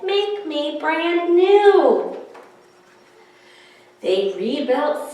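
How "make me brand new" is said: higher, slow plea; voice from the puddle. Acted. Melted snowman pleading from puddle, higher and slow. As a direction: ask slowly from the puddle. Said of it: "A voice from the puddle said".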